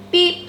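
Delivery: high, short toot; friendly truck beep. A narrator's reading. Friendly Blue greets all the animals, high beep. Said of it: "said the friendly Little Blue Truck".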